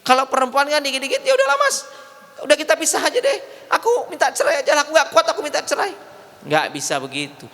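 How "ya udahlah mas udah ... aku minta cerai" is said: high, pleading mock wife; whiny wife voice. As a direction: whine it out like a fed-up wife. Wife asking husband for divorce.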